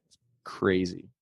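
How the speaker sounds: usual speaking voice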